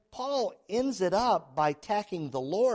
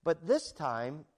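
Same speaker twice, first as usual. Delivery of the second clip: ordinary talk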